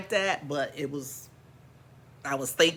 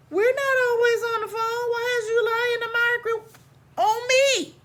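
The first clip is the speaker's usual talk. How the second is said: pitched way up, whiny, we're not always on the phone; high indignant protest; 14 semitones higher than usual. Retold or imitated. Daughter protesting to mom, high and whiny. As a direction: squeak it out like a wronged kid.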